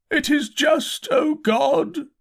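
high, drawn-out cry to God; loud raving. A narrator's reading. Half-mad curate crying out to God in hiding, loud high raving. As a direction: wail it aloud like a crazed preacher. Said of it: "began to talk aloud instead of whispering".

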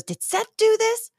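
high, slow shocked disbelief; guard finds Holly in the cage, stunned; shocked question. Retold or imitated. Guard discovers Seth's captive.

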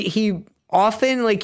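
usual speaking voice

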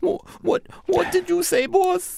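high, anxious asking; worried. Acted. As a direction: lean in to the dying boss. Dying boss, worried.

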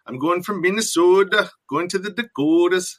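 high, singsong Upper Midwest imitation; playful Dakota accent impression; mock Minnesota accent. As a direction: ham up the Minnesota twang. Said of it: "that French Canadian thing going".

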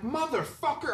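yelling at the dog chewing his shoe; mock angry yell. Tattooer telling friends about the dog, high exasperated curse.

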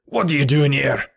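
menacing landlord confronting intruders; angry cry. A narrator's reading. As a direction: demand it as the enraged landlord. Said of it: "the man cried".

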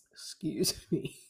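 coughing, strained voice; choking on saliva, getting words out. Real, not acted. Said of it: "swallowed my own saliva and it went down the wrong pipe".

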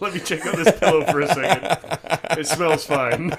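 laughing through the words; real laughter breaking the line. Real, not acted. Laughing mock pillow excuse.

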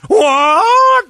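high shouted what; shouted mock what. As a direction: yell the shock like a hammy anchor. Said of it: "he just looks at you and goes".

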